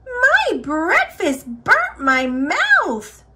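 high, slow whiny cub voice; yowling baby bear. A narrator's reading. Baby Bear complaining about a burnt mouth, yowling. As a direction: complain in a high, drawn-out little bear wail. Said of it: "yowled Baby Bear".

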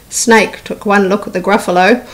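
ordinary delivery, nothing marked

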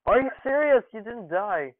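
loud, high outburst with the voice cracking; high cracking yell, easing slightly. Real, not acted. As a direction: protest in disbelief until your voice cracks. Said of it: "that was a great voice crack".

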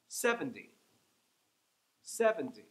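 Seventy with the first syllable strong; SEV-en-ty, first syllable strong; stress on SEV. Shown as a demonstration. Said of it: "there's three syllables there".

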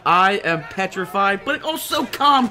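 normal talking voice

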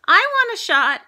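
put-on high, loud, eager child's demand; eager outburst. A narrator's reading. Boy to nurse offering dinosaurs, eager outburst. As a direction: blurt it out keenly.

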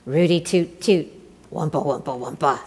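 fanfare noises. A narrator's reading. Emperor's entrance fanfare.

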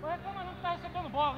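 loud, fast telling-off; scolding shout. Real, not acted. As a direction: snap at him loudly. Scolding teammate during match.

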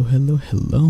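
ordinary delivery

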